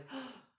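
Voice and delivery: high, sharp retold gasp; mock gasp. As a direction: gasp as if amazed, imitating. Retold reaction to baby's sounds.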